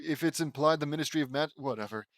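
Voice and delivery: ordinary delivery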